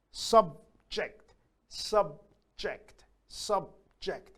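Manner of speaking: SUBJECT with a clear gap, sub, ject; split into syllables. Shown as a demonstration. Teacher counting subject, split into syllables. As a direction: pause after sub each time in subject. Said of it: "that's two syllables".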